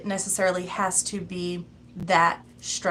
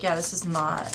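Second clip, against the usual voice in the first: normal talking voice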